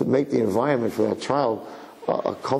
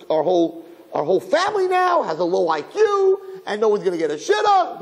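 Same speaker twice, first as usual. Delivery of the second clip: hysterical mother; 8 semitones higher than usual. Retold or imitated. Mother screaming at her son over a fifty, hammed-up and shrill-high. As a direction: play the frantic mother ranting over the grade.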